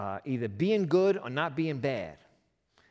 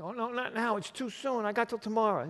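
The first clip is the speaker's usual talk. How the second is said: mock panic; 7 semitones higher than usual. Barabbas panics in retold story.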